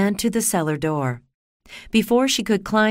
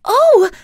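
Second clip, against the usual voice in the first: high sharp gasping oh; gasp and shocked oh; 10 dB louder than usual. Acted. Dorothy seeing the dead witch's feet, a shocked gasp. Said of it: "Dorothy exclaimed".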